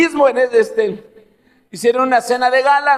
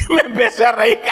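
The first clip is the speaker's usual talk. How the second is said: words broken by real laughter; laughing; 3 semitones higher than usual. Real, not acted. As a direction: laugh while telling it. (riéndose de verdad)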